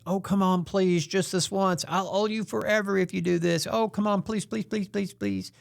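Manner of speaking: pleading and begging. Retold or imitated. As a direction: beg like a friend who won't take no. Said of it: "pleading and bargaining".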